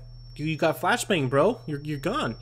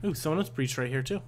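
usual speaking voice

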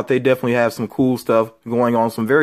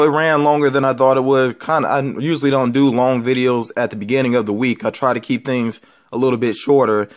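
ordinary talk, nothing marked

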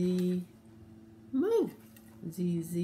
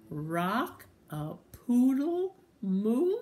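slow, loud, raised cow call; cow's crow; 4 semitones higher, 5 dB louder, much slower than usual. Acted. Cow attempting the rooster's crow, slow and loud. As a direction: stretch each word out loudly, like a questioning cow. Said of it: "this time the cow says".

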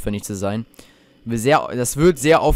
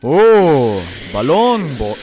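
startled yell; 8 dB louder, much slower than usual. Real, not acted. Jump scare in horror game.

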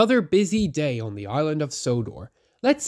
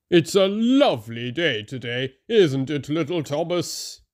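big engine greeting little Thomas; cheerful Gordon voice; 2 semitones higher than usual. A narrator's reading. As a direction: hail a small friend kindly.

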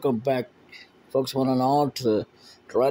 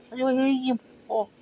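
faster high impatient voice; 12 semitones higher, much faster than usual. Acted. Repeated, faster and higher.

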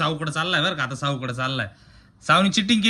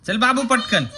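eager urging; 8 semitones higher, 5 dB louder than usual. Real, not acted. Parent urging baby to crawl over, higher louder call.

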